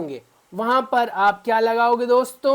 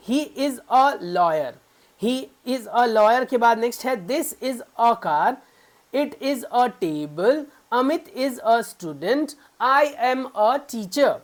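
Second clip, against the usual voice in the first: slow example reading; slower than usual. Shown as a demonstration. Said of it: "teacher reads examples slowly".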